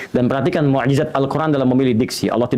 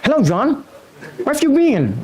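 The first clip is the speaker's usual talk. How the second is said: high-pitched imitation English accent; playful English-accent impression, pitched right up; mock English speaker; 10 semitones higher, slower than usual. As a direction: pretend to be a native English speaker. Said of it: "udah kayak orang Inggris".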